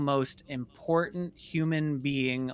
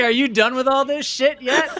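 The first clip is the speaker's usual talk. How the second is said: higher and louder through a real laugh; 12 semitones higher, 8 dB louder than usual. Real, not acted. Guest repeats his brother's line, laughing.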